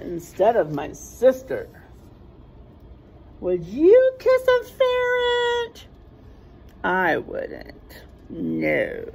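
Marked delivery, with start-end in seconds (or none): 3.4-5.9 much higher, slow teasing question; playful high voice. Acted. Asking the listening child, in a high teasing squeak.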